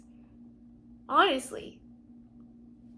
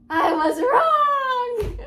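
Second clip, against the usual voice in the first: higher, louder, slow tearful admission; crying; 8 semitones higher, 6 dB louder, much slower than usual. Real, not acted. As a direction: let it break into tears.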